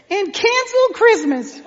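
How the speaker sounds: put-on high, slow, shouted child's version; four-year-old's villain shout. Four-year-old yelling the movie line at his parents. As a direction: belt it out like a tiny kid playing the villain. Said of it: "I get to the top of the stairs and I go".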